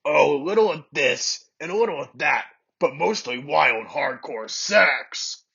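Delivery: slow, sly villain voice, stretching the end; evil glint. Acted. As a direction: gloat like an evil scientist. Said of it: "with an evil glint in his eye".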